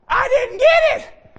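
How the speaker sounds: high-pitched, slow mock complaint; drawn-out, squeaky-high retold bafflement; baffled listeners. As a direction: imitate the confused crowd way up high. Retold laughing, baffled crowd, acted.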